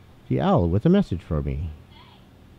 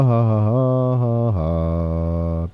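slow hammed-up laugh; 4 dB louder, much slower than usual. Slow mocking laugh at game.

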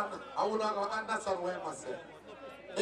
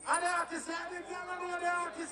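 higher, raised MC hype call; hyping the crowd; 6 semitones higher than usual. Real, not acted. MC on the mic hyping the crowd for the artist. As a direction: lift the crowd up with it.

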